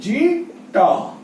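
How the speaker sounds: "Zeta" stretched very slowly, falling; slow drill. Shown as a demonstration. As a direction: hold zeta long for the class to copy. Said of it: "ये zeta". (Zeta बहुत धीमे खींचकर)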